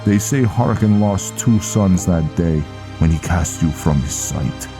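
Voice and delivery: Viking recounting a grim past. Acted. Viking reminding Velen of the ambush, solemn storytelling. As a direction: tell the old tale to a comrade, projected.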